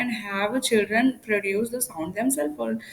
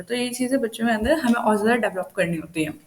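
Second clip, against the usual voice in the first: ordinary talk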